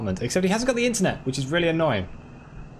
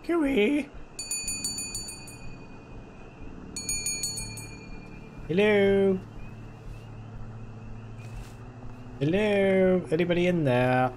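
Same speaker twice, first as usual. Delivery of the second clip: calling out; 10 semitones higher, much slower than usual. Real, not acted. Calling out at door.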